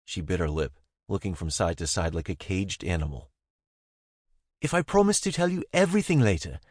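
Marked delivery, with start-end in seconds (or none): 4.6-6.6 higher pleading woman's voice; Zara, pleading. A narrator's reading. Zara asking him to trust her, pleading and high.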